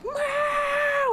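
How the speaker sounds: long high drawn-out meow; stretched, high cat yowl. Retold or imitated. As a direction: wail the meow like a deaf cat. Cat meowing at four am.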